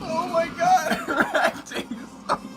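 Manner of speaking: laughing. Real, not acted. Mocking the movie's bad acting.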